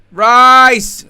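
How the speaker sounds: sudden loud high call of the name; excited shout. Real, not acted. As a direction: yell the player's name in excitement. Live match, player on the ball.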